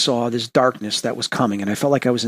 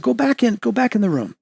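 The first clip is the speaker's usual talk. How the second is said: much higher, retold urgent instruction; urging; 10 semitones higher than usual. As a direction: hurry her back inside. Sending the scared girl away.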